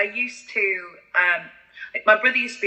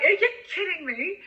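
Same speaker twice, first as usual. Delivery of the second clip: incredulous; 11 semitones higher than usual. Retold or imitated. Shocked at tribute singer pay.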